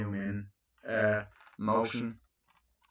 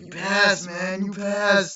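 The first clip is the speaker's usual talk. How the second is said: excited cheer; 10 semitones higher, 7 dB louder, slower than usual. Retold or imitated. Excited congratulations on phone.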